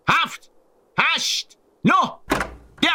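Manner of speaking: high shouted numbers, spaced out; threatening count. A narrator's reading. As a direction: finish the count high and menacing. Bully finishes the countdown.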